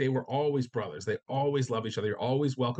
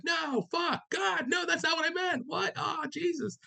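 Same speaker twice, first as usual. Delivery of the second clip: panicked shout. Retold or imitated. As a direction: yell it in horror, very high. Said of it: "he's like".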